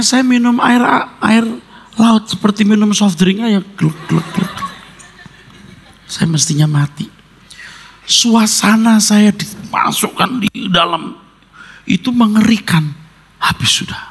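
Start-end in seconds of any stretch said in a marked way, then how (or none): 3.8-4.5 gulping sounds. Retold or imitated. Swallowing seawater like soft drink. 13.4-14.0 breathless whisper, all strength gone. Retold or imitated. Man recalls giving up while drowning, whispered.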